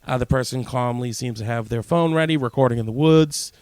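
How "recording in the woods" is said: usual speaking voice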